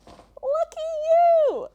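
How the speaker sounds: high, slow sarcastic cheer. Pretend. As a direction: sing it out mockingly.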